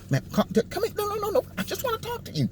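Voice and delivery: hammed-up soothing talk-show host; mocking Don Lemon. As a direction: coo it in a high soft mocking voice. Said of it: "but no, not Don".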